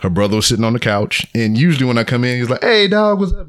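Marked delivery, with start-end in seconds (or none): 2.6-3.5 high-pitched, hearty hello to a buddy; usual friendly greeting. Retold or imitated. Brother greeting him on the couch as usual, upbeat. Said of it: "he wasn't like that this time".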